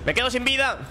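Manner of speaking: high panicked cry; alarmed. Real, not acted. About to die in game.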